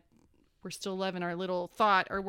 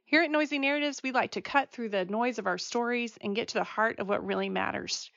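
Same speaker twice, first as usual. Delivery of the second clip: podcast intro read; 6 dB louder than usual. Acted.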